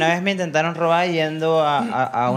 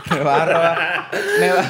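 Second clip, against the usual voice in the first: laughing; slower than usual. Real, not acted. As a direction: say it while cracking up. Laughing while quoting himself. (riéndose mientras habla)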